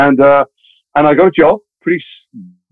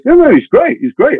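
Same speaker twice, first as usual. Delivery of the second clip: high-pitched, insistent talking-up; eager reassurance; 12 semitones higher than usual. Retold or imitated. Agent pitching her client to casting director, high eager assurance. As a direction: pitch leaps up to sell the client.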